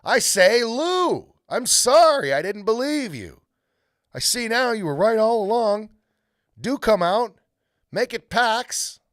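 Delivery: high, loud pleading call; shouting. Acted. Edmund shouting sorry to Lucy, loud and high. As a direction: shout an apology into the woods. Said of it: "So he shouted again".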